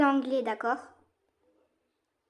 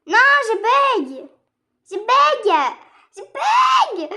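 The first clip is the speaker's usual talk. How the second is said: high, slow mock-panicked cry of "je bug"; mock glitch panic; 9 semitones higher, 9 dB louder, much slower than usual. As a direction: wail that the game is freezing, rising into a shout. Streamer's game glitches.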